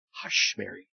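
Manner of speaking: soft unvoiced hush. Angel softly hushing frightened Mary, whispered. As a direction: breathe it out to calm her. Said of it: "So the angel said".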